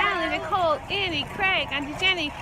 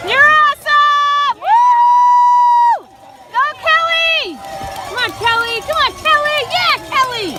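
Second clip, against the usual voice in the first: cheering shout; 6 semitones higher, slower than usual. Real, not acted. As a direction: scream encouragement to a runner. Cheering a runner named Kelly.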